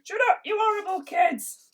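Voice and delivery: loud, high angry scold; telling kids off. Acted. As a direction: scold the children like a cross grown-up. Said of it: "if he was telling loads of kids off".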